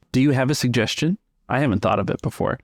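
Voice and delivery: usual speaking voice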